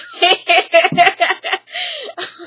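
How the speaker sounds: high loud cackling laugh; burst of laughter. Real, not acted. Reviewer cracking up at Carly driving off, loud laughter. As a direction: break into real laughter.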